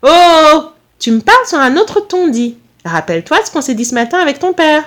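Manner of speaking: high-pitched telling-off, loud at first; acted indignant maternal reprimand; scolding mother. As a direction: pull your son up on his tone. Mother scolds son about his tone.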